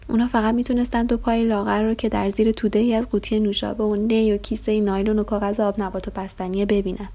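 ordinary delivery

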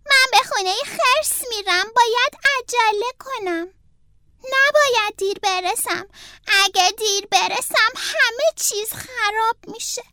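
put-on a young turtle in a rush; hurried Franklin. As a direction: Franklin telling the fox he is in a hurry. Franklin voiced worried.

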